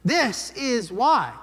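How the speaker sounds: ordinary talk, nothing marked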